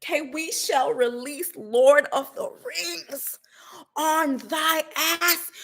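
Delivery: slow, hammed-up royal proclamation voice; mock grand decree. Mocking vengeful supporters as a pompous epic lord.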